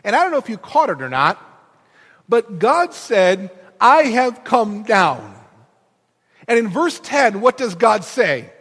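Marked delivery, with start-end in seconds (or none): 2.3-5.3 slow, loud, each word weighted; deliberate preacher emphasis; stressed slowly. Acted. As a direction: lean hard on I have come down. Preacher stressing God's words.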